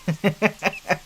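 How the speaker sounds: quick mocking chuckle in character; a rat's scoffing little laugh; short laugh. Rat mobster laughing off Yumi's muttering, a short scoffing chuckle.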